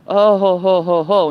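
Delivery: loud mocking ho ho ho; gloating laugh. Real, not acted. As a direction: crow over him with a loud taunting laugh. Gloating at Wookie.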